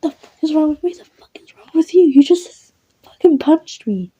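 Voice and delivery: high, loud indignant outcry; furious outburst. Acted. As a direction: yell in high-pitched outrage at being punched. Confronts listener who punched her.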